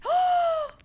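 sudden high yelp of fright; startled shriek. Real, not acted. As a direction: jump out of your skin. Jump scare reaction.

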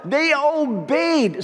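high, slow, awed delivery; mock astonishment, drawn out high; amazed emphasis. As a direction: marvel at it from high up.

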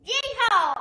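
loud high yeehaw; cowboy yell. Acted. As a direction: whoop like a cowgirl. Cowgirl costume whoop.

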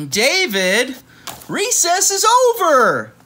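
high, loud, slow call across the playground; teacher calling. Acted. Teacher calling David in from recess, long loud call.

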